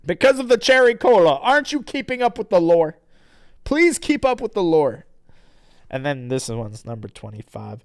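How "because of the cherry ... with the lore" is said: high, loud, fast scolding; indignant defence of the lore; mock exasperation. Real, not acted. Reader scolds interrupting listener about lore, mock exasperated.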